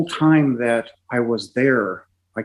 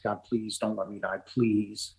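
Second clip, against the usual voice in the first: much softer, hushed pleading with a touch of tears; retold tearful plea; 2 semitones lower, 11 dB quieter than usual. Patient alone in the ICU begging God to live, hushed tearful plea. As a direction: beg God softly, as if barely holding back sobs. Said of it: "So I began to sob".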